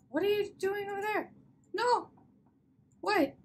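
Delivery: high-pitched, alarmed cry. Acted. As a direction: call out in sudden high alarm. Acted alarm at someone approaching danger.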